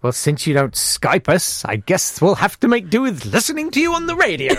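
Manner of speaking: mock parents' voice rising high on the last words; impression of guest's parents. Host teasing guest as her parents abroad, mock parent voice. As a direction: draw it out, climbing to a high, teasing finish. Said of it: "That's exactly how they sound".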